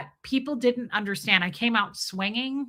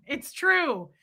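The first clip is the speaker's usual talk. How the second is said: ordinary delivery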